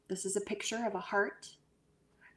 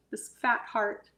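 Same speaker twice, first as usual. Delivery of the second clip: ordinary delivery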